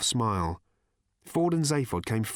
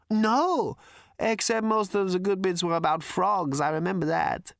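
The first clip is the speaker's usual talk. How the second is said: spaced-out hermit; 8 semitones higher than usual. Acted. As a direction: drift off like a stoned old hermit. Arthur asks, Prak answers.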